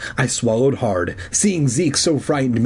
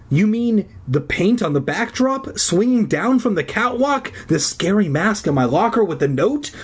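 high, rising list of disbelief; astonished; 9 semitones higher than usual. A narrator's reading. Kid realising who was behind the scares, astonished. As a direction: reel off each clue in astonishment. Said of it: "I cried in astonishment".

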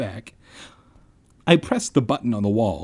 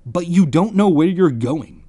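louder, higher annoyed scolding; angry; 5 semitones higher, 5 dB louder than usual. A narrator's reading. Friend scolding boy who got lost, angry. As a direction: scold with a touch of anger. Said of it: "he said angrily".

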